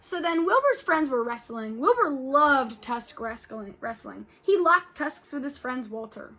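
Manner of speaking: lively read-aloud with character voices; storybook read-aloud for kids; reading to children. Acted. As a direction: read it to the class with expression.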